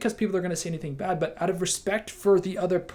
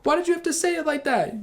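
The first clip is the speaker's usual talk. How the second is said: much higher, indignant complaint; imitating an upset person; 12 semitones higher, 3 dB louder than usual. Upset teammate confronting you, mock indignant. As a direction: whine it like someone hurt and offended. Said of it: "they're saying".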